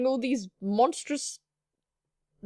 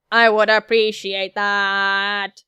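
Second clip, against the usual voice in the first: slow, level robotic monotone, louder; matter-of-fact android; 3 semitones lower, 7 dB louder, much slower than usual. Acted. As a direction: draw it out mechanically on one note. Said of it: "the words come out as matter-of-factly".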